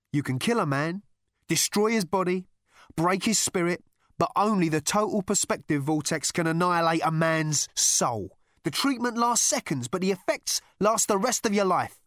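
loud, high-pitched intense warning; urgent. A narrator's reading. Rooster explains the vortex torture to Zaphod, urgent. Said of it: "said Rooster urgently".

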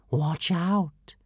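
whispered "Watch out." falling slowly at the end; whispered warning. A narrator's reading. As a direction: breathe "Watch out." as a fading threat. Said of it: "they sang a song".

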